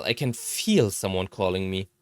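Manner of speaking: ordinary delivery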